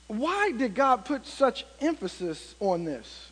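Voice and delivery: normal talking voice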